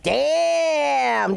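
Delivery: long, high drawn-out exclamation; imitated crowd reaction. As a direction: stretch it out like an impressed crowd. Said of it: "Everybody was like".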